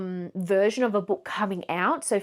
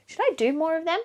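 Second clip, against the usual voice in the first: ordinary delivery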